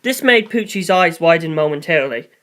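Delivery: plain reading voice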